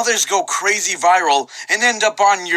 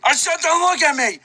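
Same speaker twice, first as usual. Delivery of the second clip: shouting; 9 semitones higher than usual. Acted. Imagined dudes' shouting scene.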